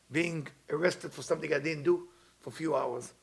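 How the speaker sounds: normal talking voice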